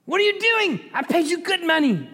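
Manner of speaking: high-pitched comic indignation; outraged king. Acted. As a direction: protest in a squealing put-on voice. Angry king protests third time.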